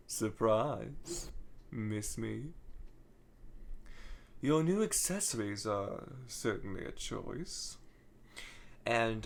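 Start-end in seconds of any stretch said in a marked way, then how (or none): 0.1-7.8 softer, low and sinister villain voice; a quiet, menacing phantom's tease; sinister Starline. Retold or imitated. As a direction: purr it like a cool, taunting phantom. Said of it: "this very cool, sinister tone".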